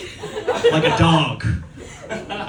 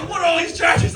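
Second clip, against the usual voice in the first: mock outraged shout; 11 semitones higher than usual. Customer yelling at a surprise credit card charge, mock outrage. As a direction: shout at the bill in disbelief.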